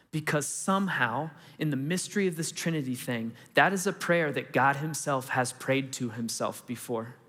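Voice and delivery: normal talking voice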